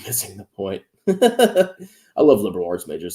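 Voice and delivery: usual speaking voice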